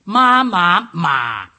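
tone drill dropping to the bottom of the voice; third tone demo. Shown as a demonstration. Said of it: "your voice is galloping down the bottom".

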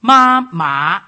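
"Ma, ma" loud, high, then swinging upward; loud tone drill ending in a rising "ma"; second tone demo. Shown as a demonstration. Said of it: "has a rising inflation".

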